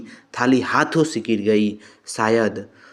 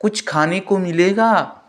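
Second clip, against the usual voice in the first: high, raised call from another room; 8 semitones higher than usual. Acted. Mother-in-law calls out to bahu for lunch, impatient and raised. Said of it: "सास ने फिर आवाज़ लगाई".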